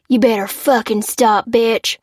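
high, loud menacing shout; threatening yell. A narrator's reading. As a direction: chase her down with threats. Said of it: "he yelled".

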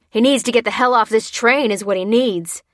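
loud, forceful comeback; aggressive retort. A narrator's reading. Bearded aggressor answering Lucy, angry retort. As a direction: throw her words back angrily. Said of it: "replied the bearded aggressor".

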